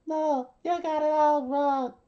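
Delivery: put-on very high, loud child voice; protesting kid. A narrator's reading. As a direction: protest in a squeaky kid's voice. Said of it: "The kid said".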